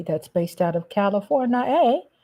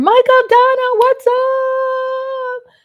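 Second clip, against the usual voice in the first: high, loud sing-song shout-out; excited greeting; 7 dB louder, much slower than usual. Real, not acted. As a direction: call out to the viewer with big energy. Streamer greets a chat member loudly.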